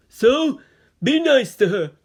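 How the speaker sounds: teacher voice. A narrator's reading. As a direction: introduce the new girl to the class. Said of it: "the teacher finished".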